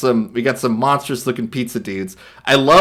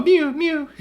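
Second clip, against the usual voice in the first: kitten mew; 14 semitones higher than usual. Retold or imitated. Imitating a tiny cat.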